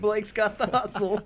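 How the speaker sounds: high, laughing singsong; laughing tease. Pretend. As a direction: tease him through a laugh.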